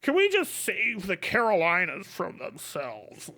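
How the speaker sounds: lightly voiced condescending Californian; mock Californian. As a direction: patronize like a savior. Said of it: "Californians are like".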